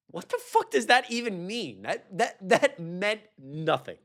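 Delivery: incredulous, half-yelling at the screen; exasperated outburst. Real, not acted. Streamer reacting to a clip, exasperated disbelief. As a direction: pitch jumps way up in disbelief.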